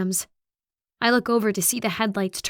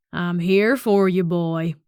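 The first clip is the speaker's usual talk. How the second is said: loud, menacing Southern drawl; big Southern-accented holler; thick Southern accent; 2 semitones lower, 4 dB louder, slower than usual. A narrator's reading. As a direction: call to the hidden one in a Southern accent. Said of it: "such a thick Southern accent".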